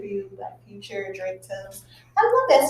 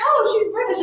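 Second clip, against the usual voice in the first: imitated realization; 11 semitones higher, 5 dB louder than usual. Acting out the man's conclusion.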